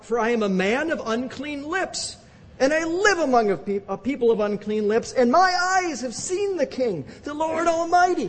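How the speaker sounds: high, pleading cry of despair; anguished lament. Retold or imitated. Isaiah confesses his unclean lips, high anguished cry. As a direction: lament like a man who knows he is lost. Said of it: "I cried".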